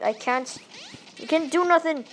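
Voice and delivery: ordinary talk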